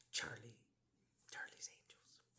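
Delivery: zacht gefluisterd, bijna zonder stem; whisper. Acted. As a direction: breathe the name as a secret. Whispered aside about angels.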